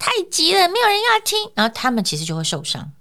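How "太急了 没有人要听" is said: high, tight and piercing; sharp tight voice. Shown as a demonstration. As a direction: strain the voice up and sharp. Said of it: "我的声音如果很直很尖".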